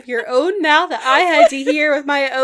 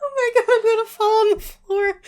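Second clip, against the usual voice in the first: fast, breathless through laughter; laughing; 6 semitones higher, faster than usual. Real, not acted. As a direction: gasp it out mid-laugh. Laughing fit, fast speech.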